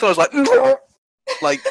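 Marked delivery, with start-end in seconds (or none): 0.2-0.3 high squeaky laugh out the nose; recreated choking laugh. Retold or imitated. Reliving the onion moment for friends, squeaky laugh. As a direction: redo the noise you made at dinner. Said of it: "that was literally the noise I made was".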